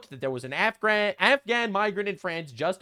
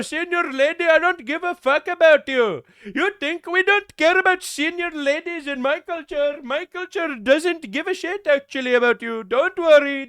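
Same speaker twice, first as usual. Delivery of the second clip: mock Indian-style accent; 7 semitones higher, 6 dB louder than usual. Mocking the cop to the senior lady, loud mock accent. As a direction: parody the cop's accent, brash and dismissive. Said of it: "my Canadian accent".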